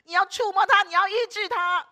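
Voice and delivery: loud, high, pleading prayer cry. Retold or imitated. Wife lays hands on him, crying out loud in prayer. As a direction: cry out to Jesus with all your voice. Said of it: "大声的呼喊说".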